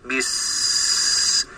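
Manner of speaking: بس whispered with a sharp hissing s; whispered s drill. Shown as a demonstration. Teacher drilling a clear seen sound. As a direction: hiss out بس with the tongue pulled back. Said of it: "عشان أسمعها".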